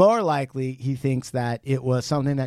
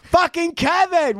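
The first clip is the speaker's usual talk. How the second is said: much higher, louder, drawn-out shout of his name; exasperated yell; 4 dB louder than usual. Real, not acted. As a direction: bellow at Kevin like he's driving you nuts. Yelling at Kevin mid-conversation.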